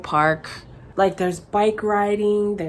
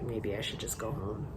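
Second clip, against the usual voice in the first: much lower and far softer, almost to herself; dejected inner thought; 10 semitones lower, 18 dB quieter than usual. Retold or imitated. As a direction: sink into quiet self-doubt. Feeling she didn't belong.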